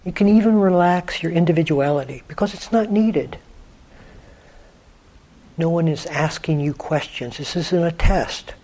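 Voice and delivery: guided meditation. Acted. Teacher guiding listeners to let the quiet in, unhurried meditation voice. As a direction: guide listeners gently inward, leaving long silences.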